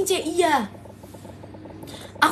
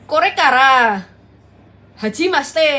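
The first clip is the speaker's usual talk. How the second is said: thick Japanese accent; 5 dB louder, slower than usual. Shown as a demonstration. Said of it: "Jepang medok".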